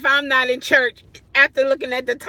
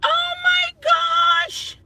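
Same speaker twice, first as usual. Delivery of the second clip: high, drawn-out shout that turns into a laugh; real embarrassed laughing shout; laughing shriek. Real, not acted. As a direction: squeal through giggles. Laughing at her own typo.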